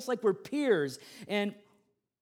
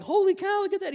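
high, loud amazement; astonished exclamation; 7 dB louder than usual. Retold or imitated. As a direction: re-enact shock at a startling find. Retold shock at the bee.

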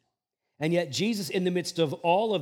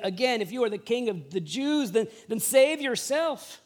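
raised, jeering challenge; soldiers mocking; 8 semitones higher than usual. Soldiers offering sour wine, taunting the king in a raised voice. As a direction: dare him to save himself. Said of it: "The soldiers also mocked him".

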